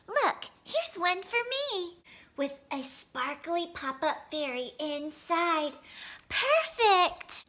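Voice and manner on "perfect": high bright cry of delight; delighted exclaim. A narrator's reading. Girl delighted by her pop-up card, a high happy cry. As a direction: squeal it with pure joy.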